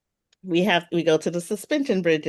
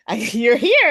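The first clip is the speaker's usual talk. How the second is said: happy greeting; 4 semitones higher, 7 dB louder than usual. Retold or imitated. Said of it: "they were like".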